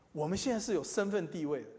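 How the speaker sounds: normal talking voice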